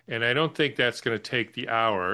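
ordinary delivery

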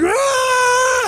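very high shouted burst of power; mock roar. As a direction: let out one sudden enhanced yell. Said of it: "and he's like".

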